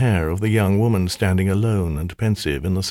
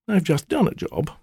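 higher, sulky temptress voice; sulky maiden; 9 semitones higher than usual. Acted. Spirit complaining to her master, sulky. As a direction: pout at the demand.

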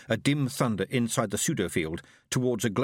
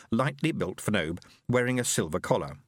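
ordinary delivery

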